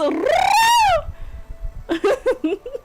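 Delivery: long, high trilled R breaking into laughter; exaggerated Scottish R trill; rolled R. Shown as a demonstration. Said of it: "Roll your ass".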